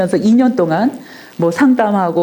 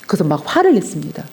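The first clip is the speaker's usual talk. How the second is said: ordinary delivery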